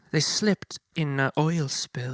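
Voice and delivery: put-on high, slow, grand old-movie actress voice; fifties film diva. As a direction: ham up a dramatic fifties screen diva. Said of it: "she's very much like that".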